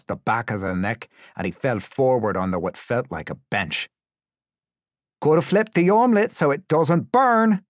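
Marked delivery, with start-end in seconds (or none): 5.2-7.6 loud, high, gleeful sing-out; jolly tormentor, pitched way up; cheery. A narrator's reading. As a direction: call it out cheerily. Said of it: "someone said cheerily".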